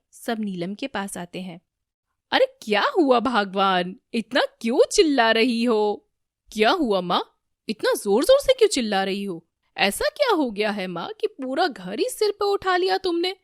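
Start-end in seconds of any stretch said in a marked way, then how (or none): 2.3-13.4 loud, exasperated questioning; loud alarmed scolding. A narrator's reading. Family asks the screaming mother what happened, loud alarm. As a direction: demand loudly what the fuss is.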